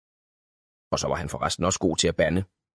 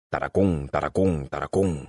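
lower, rhythmic gallop sound; galloping chant; 4 semitones lower than usual. A narrator's reading. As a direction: drum out the hoofbeats.

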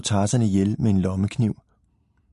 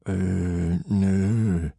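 dazed hesitation. A narrator's reading. Leo answers girl after his fall, dazed. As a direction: drag out each sound, unsure.

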